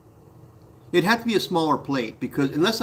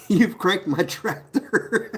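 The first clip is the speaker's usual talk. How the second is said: slow, read out through real laughter; slow laughing read-out; 5 semitones higher, much slower than usual. Real, not acted. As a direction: read the comment while cracking up. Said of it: "I didn't hear that one before".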